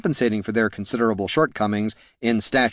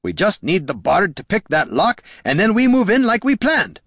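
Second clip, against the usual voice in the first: barked; 8 semitones higher than usual. A narrator's reading. Gorm pressing the party to follow his plan, barked. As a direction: build to an exasperated bark. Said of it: "barked Gorm".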